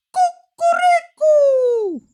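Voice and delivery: high, slow, drawn-out crow; angry rooster crow. A narrator's reading. Rooster on a stick crowing angrily, drawn-out. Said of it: "kogut na patyku zapiał gniewnie".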